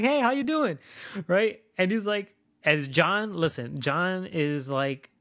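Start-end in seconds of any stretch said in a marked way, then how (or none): 0.0-0.8 high, bright fake friendliness; fake cheerful. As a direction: greet him sweet as if nothing happened. Fake friendly greeting to driver.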